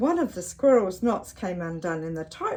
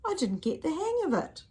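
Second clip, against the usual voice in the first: little bird voice; 9 semitones higher than usual. Acted.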